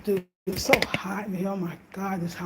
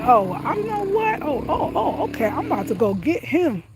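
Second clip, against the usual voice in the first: sputtering with rage, pitch climbing; angry; 8 semitones higher than usual. Retold or imitated. Woman retelling how mad she got at him, angry and high. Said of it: "my anger".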